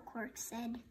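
plain reading voice

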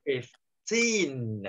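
put-on "fifteen" very slow, TEEN held long; stress on second syllable. Shown as a demonstration. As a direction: keep FIF down and stretch the end of "fifteen". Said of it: "trọng âm nó sẽ rơi vào âm hai". ("fifteen" đọc chậm, nhấn và kéo dài âm "teen")

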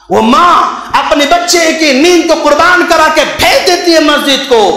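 high, ringing sermon delivery; fervent preaching praise. Acted. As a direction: hail these parents from the pulpit. (जोश भरी तारीफ़)

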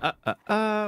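thinking-noise imitation, pitched high; Doubt. Shown as a demonstration. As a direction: stall on repeated fillers as if mimicking someone thinking. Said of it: "when I think".

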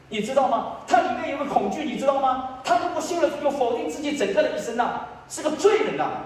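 high-pitched, urgent explanation; impassioned preaching. Real, not acted. Preacher explaining to listeners why parents fear believing, impassioned. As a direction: drive it home high and insistent.

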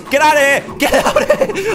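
high, loud yell breaking into laughter; laughing panic at the crowd; laughing shout. Real, not acted. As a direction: shout it while cracking up. Laughing while shooing them off.

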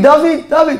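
high-pitched mock yell; retold calling out. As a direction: call the name high, without real volume. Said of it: "היא צועקת".

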